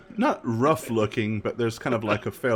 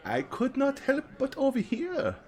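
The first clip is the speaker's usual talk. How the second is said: put-on high, slow, theatrical old fortune teller; 7 semitones higher, 4 dB quieter, slower than usual. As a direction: drawl it out like a mystical seer. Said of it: "He says".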